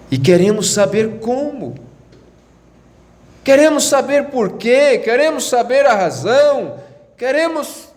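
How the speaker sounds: high, insistent pulpit cry; impassioned preaching. Acted. Preacher presses rising questions.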